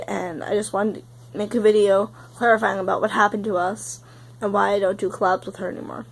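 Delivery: ordinary talk, nothing marked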